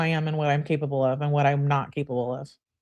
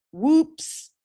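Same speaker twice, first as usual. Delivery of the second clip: mock whoops; 9 semitones higher than usual. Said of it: "She says".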